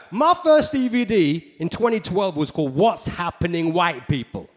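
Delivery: ordinary delivery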